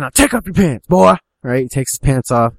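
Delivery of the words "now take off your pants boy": loud, raised-pitch command; acted shouted demand; angry father's order. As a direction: order the boy about, punching the last word. Father orders son in story.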